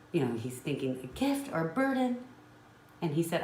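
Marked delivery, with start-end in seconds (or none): 1.0-2.2 higher, a mocking squeak of disbelief; incredulous echo. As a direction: toss the question back in disbelief. Son repeating mother's question incredulously.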